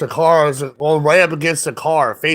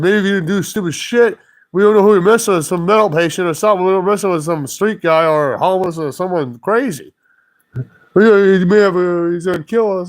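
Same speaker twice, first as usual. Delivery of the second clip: mock-frightened, raised voice; imitating scared drivers; 3 semitones higher than usual. Driver scared of someone crazy, mock panic. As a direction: retell the drivers' worried protest. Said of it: "just scared shitless".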